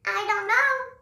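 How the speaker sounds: high, drawn-out helpless squeak; mock clueless reply. Lost newcomer answering old local, high mock-clueless squeak. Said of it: "et que j'ai répondu".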